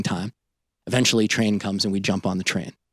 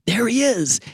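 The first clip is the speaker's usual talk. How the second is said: spotting him; 12 semitones higher than usual. Retold or imitated. Passenger spots the brother.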